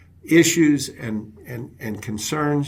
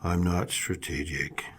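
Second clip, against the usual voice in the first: low, subdued mock confession; 6 semitones lower, 7 dB quieter than usual. Employee admits the problem to the coach, in a low voice. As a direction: imitate someone admitting it softly, pitched down. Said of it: "in a low voice".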